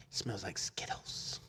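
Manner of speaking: slow, soft imitated whisper; whispered relish. Woman tells the funeral staff about the smell, whispering. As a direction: whisper it slowly, drawing out the last word.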